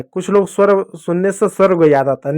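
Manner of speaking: ordinary delivery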